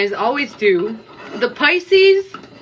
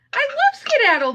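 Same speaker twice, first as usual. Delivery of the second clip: high indignant protest; 14 semitones higher than usual. Real, not acted. Protesting the blocked viewer.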